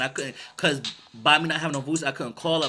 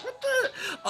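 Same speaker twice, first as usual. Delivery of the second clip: baffled. Retold or imitated. Reacting to fake greeting.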